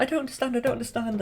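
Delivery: high baffled bluster; confused king. Retold or imitated. Hubert baffled again as they dance, high. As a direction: splutter in confusion. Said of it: "Hubert's like".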